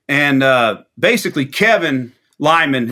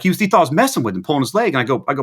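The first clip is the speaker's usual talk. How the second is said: normal talking voice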